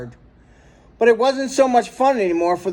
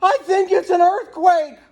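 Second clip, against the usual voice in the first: much higher panicked cry; frightened exclaim; 13 semitones higher, 3 dB louder than usual. A narrator's reading. Mister Merriweather cries out to his children about an earthquake, much higher. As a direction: blurt it out in terror. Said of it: "Mister Merriweather exclaimed".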